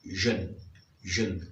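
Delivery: jeune said low, for the English ending. Shown as a demonstration. Teacher showing francophones the English suffix sound. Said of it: "vous prononcerez".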